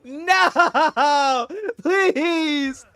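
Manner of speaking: high, loud desperate begging; pleading scream impression. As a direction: scream "no, please" like a cartoon victim. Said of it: "How'd you like the impression?".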